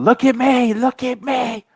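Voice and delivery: high, loud sing-song look at me; mock teacher demand. Teacher calling out a zoning student, high mock demand. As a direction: mimic a shrill teacher. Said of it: "she just started going".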